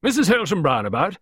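high, loud, brusque query. A narrator's reading. Visitor on the window step, brusque question. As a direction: ask it loudly, without ceremony. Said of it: "she asked brusquely".

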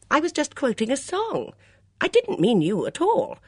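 high, loud, earnest explaining; flustered apology. A narrator's reading. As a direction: insist you meant no offence. Bunch explains herself to waitress.

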